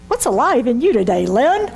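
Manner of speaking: a touch of overly earnest greeting; stilted mock greeting. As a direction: ask it with exaggerated sincerity. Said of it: "we don't talk like that".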